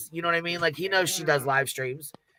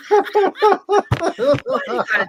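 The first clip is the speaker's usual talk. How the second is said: high pitched laughing protest; 10 semitones higher, 7 dB louder, much slower than usual. Real, not acted. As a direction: laugh through the words. Laughing at the clip.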